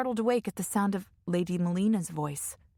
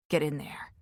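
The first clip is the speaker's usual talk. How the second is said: grunted guard; 4 semitones lower than usual. A narrator's reading. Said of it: "the guard grunted".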